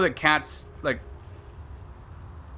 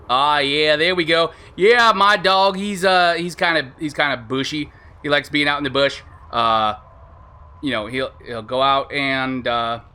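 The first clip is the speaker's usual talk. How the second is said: casual mock-Australian chat; loosely held Australian accent; Australian accent attempt. Shown as a demonstration. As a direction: settle into an Australian accent, then let it slip. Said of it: "nope, I lost it".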